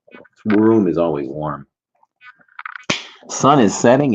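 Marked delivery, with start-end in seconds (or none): 3.3-4.1 radio DJ. Acted. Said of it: "Sound like a radio D J, huh?".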